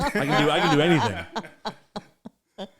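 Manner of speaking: slow mock boast through laughter; hammed-up bravado, laughing; laughing brag. Joking tough persona bragging.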